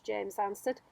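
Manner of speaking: ordinary delivery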